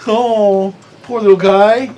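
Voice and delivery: loud, high, hammed-up baby talk to a sulking player; mock pity. DM mocks the player whose sword vanished, in loud mock pity. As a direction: coo over him like a pouting child.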